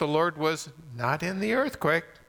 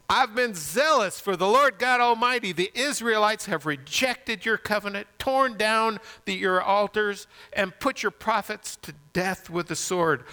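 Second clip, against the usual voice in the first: higher-pitched, emphatic complaint; Elijah's protesting reply; 7 semitones higher than usual. Acted. Elijah answering God, in aggrieved protest. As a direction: plead your case with rising insistence. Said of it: "what was his reply?".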